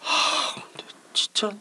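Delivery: puzzled whisper to himself. Acted. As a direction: whisper it, baffled. Brother muttering, puzzled and alone.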